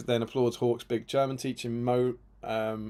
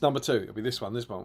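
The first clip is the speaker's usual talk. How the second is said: usual speaking voice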